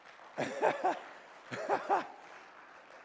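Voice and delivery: airy laugh way up high; high unvoiced giggle; high breathy laugh. Real, not acted. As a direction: burst out laughing at the memory. Laughs at his own story.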